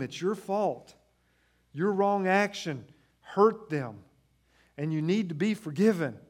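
fiery sermon climb; preaching at full force. Acted. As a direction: drive the guilt home from the pulpit. Preaching the realization of guilt.

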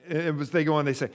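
normal talking voice

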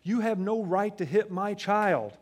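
high, loud stern telling-off; father scolding daughter, slowing on the last line; angry dad scolding. Retold or imitated. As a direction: scold her like a dad who thinks she hit his son. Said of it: "I'm like".

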